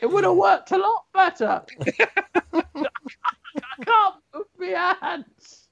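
high puppet monkey, laughing, then very slow whining; monkey complaining it can't move its hands; high slow whiny monkey. Acted. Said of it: "I don't know where the accent was going".

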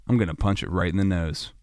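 low, soft, unbothered mumble to oneself; mock nonchalance. Swimmer calmly planning to fight a shark, low and nonchalant. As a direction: play it absurdly calm.

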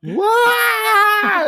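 very high, loud mock shriek; hammed-up startled screech; shocked scream. Imitating shocked woman losing her top.

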